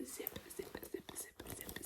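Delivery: quick repeated soft whisper; whispered trigger word. Shown as a demonstration. ASMR host zipping something up, whispered repetition.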